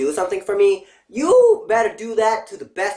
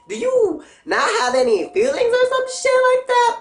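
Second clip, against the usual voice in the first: laughing disbelief; 3 dB louder than usual. Real, not acted. Ranting about friend's betrayal.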